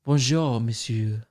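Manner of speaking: suave long-haired Frenchman, falling away softly; put-on French accent; mock French accent. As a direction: purr a French greeting as a parody accent. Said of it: "he says something like".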